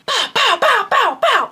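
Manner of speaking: high, punchy gunfire noises; spoken gunshot sound effect; imitated gunshots. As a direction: fire off vocal gunshots, evenly repeated.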